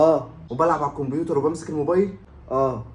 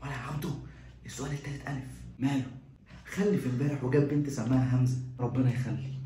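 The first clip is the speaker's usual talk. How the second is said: much softer hushed talk; whispered exchange; 3 semitones lower, 6 dB quieter than usual. Acted. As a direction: keep it low so nobody hears. Whispered cheating in exam.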